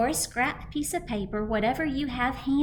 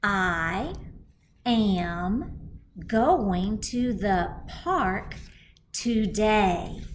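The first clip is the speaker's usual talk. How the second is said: slow read-aloud; 3 dB louder, much slower than usual. Shown as a demonstration. Teacher reading the sentence aloud with kindergartners. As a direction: read "I am going to the park today" very slowly, word by word. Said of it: "I want to read that with you".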